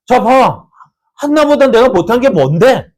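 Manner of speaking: a wife furiously pressing her husband; jealous wife confronting her husband; angry confronting. Acted. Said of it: "하면서 엘가나에게 막 따졌을 거예요".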